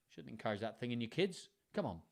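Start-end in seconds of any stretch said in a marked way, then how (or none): none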